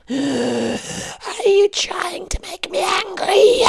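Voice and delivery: a ghostly demon taunting from above; eerie high whispering monster. A narrator's reading. Villain Akasu taunts.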